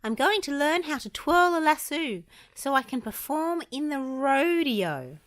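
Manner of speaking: high, goofy cartoon voice, slowing at the end; eager goofy dreamer. A narrator's reading.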